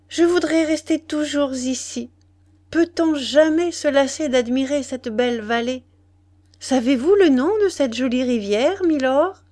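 lifted, excited heroine voice; enraptured young wife. A narrator's reading. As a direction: admire the view with delight.

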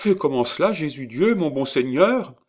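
high, alarmed pleading; frightened wife. A narrator's reading. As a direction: gasp it out in alarm. Frightened wife asks how.

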